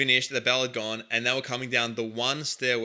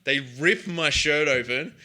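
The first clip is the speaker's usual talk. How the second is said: ordinary talk, nothing marked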